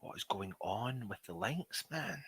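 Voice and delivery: muttered annoyance. Real, not acted. Annoyed at broken stream links.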